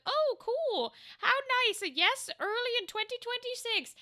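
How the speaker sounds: bright, high, chirpy self-quote; cheerful high voice. Retold or imitated. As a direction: chirp it out like a pleased airhead. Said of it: "and I was like".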